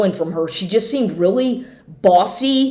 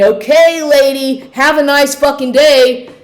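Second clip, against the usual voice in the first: loud, higher, sing-song sarcasm; sarcastic send-off; 5 semitones higher, 4 dB louder than usual. Retold or imitated. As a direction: dismiss her with exaggerated mock politeness.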